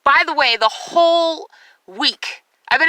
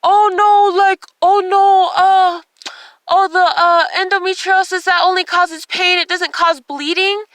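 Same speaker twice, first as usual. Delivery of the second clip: higher, halting imitation of the doctor; mock doctor; 5 semitones higher, 3 dB louder than usual. Doctor wrongly dismisses patient's bleeding, high halting mock voice. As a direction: mimic his dismissive waffling in a raised voice. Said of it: "And he's like".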